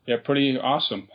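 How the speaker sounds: usual speaking voice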